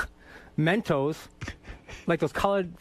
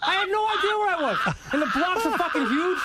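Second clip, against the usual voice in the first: he cracks up retelling his awful driving to the hosts, laughing; laughing while telling; 10 semitones higher than usual. Real, not acted. Laughing through the parking story.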